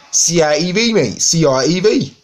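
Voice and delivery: high, loud Cockney with the T dropped; exaggerated East London Cockney; hammed-up Cockney. Shown as a demonstration. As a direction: belt it out as an indignant Cockney. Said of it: "I'm a Cockney".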